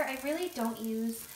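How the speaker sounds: ordinary talk, nothing marked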